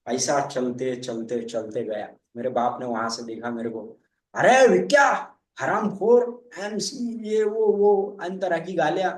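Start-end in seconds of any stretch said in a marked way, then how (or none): 4.4-8.2 loud, slow, high furious shouting; angry father's yell. Retold or imitated. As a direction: bellow it like a fed-up father yelling at his drunk son. Drunk son's father yelling. (गुस्से में चिल्लाता बाप)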